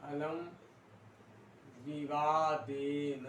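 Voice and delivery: अलम alone, then विवादेन drawn out very slowly; word-by-word slow dictation. Shown as a demonstration. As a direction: stretch each word so students can write it. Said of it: "repeats translation for dictation".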